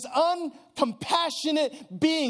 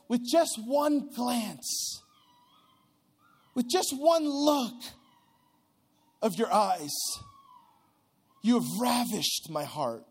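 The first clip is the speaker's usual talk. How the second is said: slow tender reading; slower than usual. Shown as a demonstration. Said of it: "it says".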